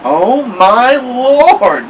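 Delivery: high, loud, long-drawn wail; scream. A narrator's reading. Mama sees her son stuck to the mirror, scream. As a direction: wail it out in horror. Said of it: "Mama screamed".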